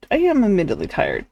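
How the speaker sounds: ordinary talk, nothing marked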